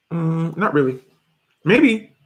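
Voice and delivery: ordinary delivery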